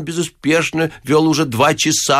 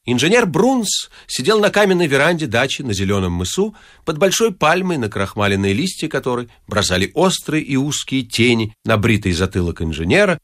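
ordinary delivery, nothing marked

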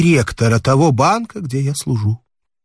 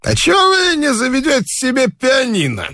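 another voice; 13 semitones higher than usual. A narrator's reading. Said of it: "спросил другой голос".

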